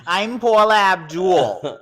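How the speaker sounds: loud and drawn out; loud, slow announcement. Pretend. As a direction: declare it slowly and loud. Playful self-declaration, drawn out.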